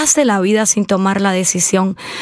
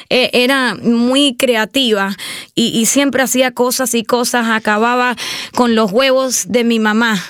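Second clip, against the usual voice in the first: normal talking voice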